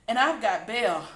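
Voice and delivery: panting from the chase; breathless, winded words; out of breath. A narrator's reading. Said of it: "panted Sam".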